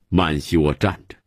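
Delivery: ordinary delivery, nothing marked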